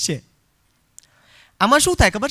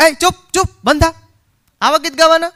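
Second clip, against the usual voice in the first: scolding teacher; 8 semitones higher, 5 dB louder than usual. Retold or imitated. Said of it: "madam".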